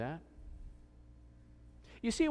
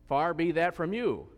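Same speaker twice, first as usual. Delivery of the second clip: raised, measured reading of the line; scripture reading; 4 semitones higher, 8 dB louder than usual. Acted. Preacher reads Abraham's plea to the congregation, measured reading. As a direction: read it as part of the passage. Read within the scripture passage.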